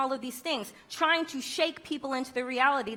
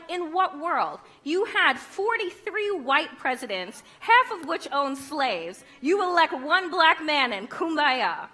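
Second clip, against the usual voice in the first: indignant retort; 5 semitones higher, 4 dB louder, slower than usual. Retold or imitated. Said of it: "I was like".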